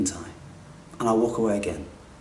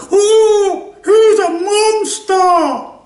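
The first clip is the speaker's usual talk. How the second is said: shrieking high and loud, dragged slowly, a wailing mock cry; mock outraged crowd; 8 dB louder, much slower than usual. Mimicking people who condemn a killer, mock shrieking wail. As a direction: ham up horrified onlookers wailing. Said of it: "When people go".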